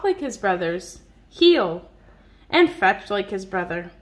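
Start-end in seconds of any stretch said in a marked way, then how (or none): none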